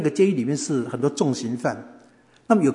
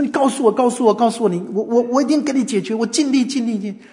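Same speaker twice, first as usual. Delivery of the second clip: high-pitched, repetitive, tumbling reassurance; flustered urgency; 7 semitones higher than usual. Retold or imitated. Host to crying visitor, flustered urgency. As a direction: promise help in a panic. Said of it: "那个人更急啊".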